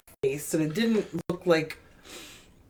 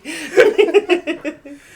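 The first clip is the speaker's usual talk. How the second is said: loud burst of laughter; laughing; 10 semitones higher, 9 dB louder than usual. Real, not acted. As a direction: break into a real laugh. Laughs at own joke.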